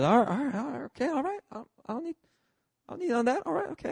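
high, meek surrender; hasty high-voiced giving in; backing off. Backing off from the shouting bouncer.

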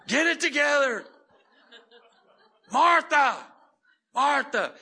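high, shouted mock rebuke; loud comic imitation of losing patience; scolding Jesus. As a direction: yell it in hammed-up exasperation. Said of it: "I would hear Jesus go".

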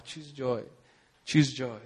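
low, softened murmur to oneself; calm self-prompting. Retold or imitated. Man sat there repeating God's word, calm and quiet.

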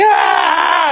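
mock bloody-murder wail; imitated screaming cry. Father mimicking his son when offered ice cream, mock crying scream. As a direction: scream like an inconsolable child, in imitation. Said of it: "he was crying bloody murder".

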